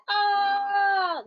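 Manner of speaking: a high, sharp yelp of disgust; startled cry. Acted. The Goat Avenger running into the stink cloud, a high yelp. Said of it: "He said".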